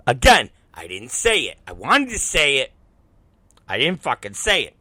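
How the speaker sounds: ordinary delivery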